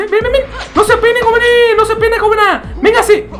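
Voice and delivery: sing-song come-here bait; mocking high voice calling a foe over; falsetto taunt. Taunting opponent in-game.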